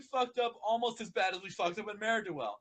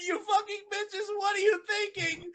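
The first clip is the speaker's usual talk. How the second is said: much higher, tearful yelling; crying rage; 11 semitones higher than usual. Acted. Spike shrieking at the ponies, near tears. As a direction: scream it through tears.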